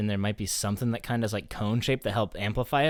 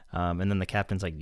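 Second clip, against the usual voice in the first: normal talking voice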